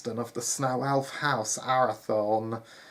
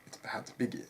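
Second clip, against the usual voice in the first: ordinary delivery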